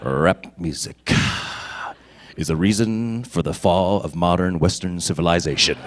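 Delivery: solemn verdict, every word weighed; deadpan Vulcan impression. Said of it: "he's like this".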